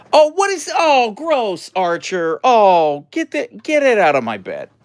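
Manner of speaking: high, loud disgusted cry; grossed-out yelp at the dog. Real, not acted. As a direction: recoil from the mess. Finds trachea in bed.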